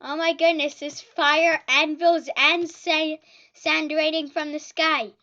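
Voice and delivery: loud frantic exclamations; panicked shouting. Real, not acted. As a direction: yell in frantic disbelief. Anvils raining in game.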